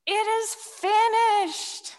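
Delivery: slow, high, ringing cry; the dying shout of completion; loud final cry. Acted. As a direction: draw it out as a last triumphant cry. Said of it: "cries out in a really loud voice".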